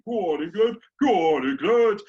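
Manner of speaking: loud, high mock terrace shout, building; fan's cheer. British fan cheering on his country, loud hammed-up chant. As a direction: roar it like a partisan England supporter.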